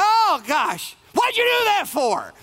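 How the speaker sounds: high, far exasperated yelp; mock annoyed startled cry; mock irritated outburst. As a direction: snap back like you've just been startled. Acting Mary ticked at angel.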